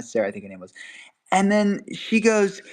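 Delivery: ordinary delivery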